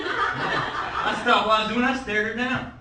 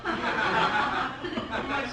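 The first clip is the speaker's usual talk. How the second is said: ordinary talk, nothing marked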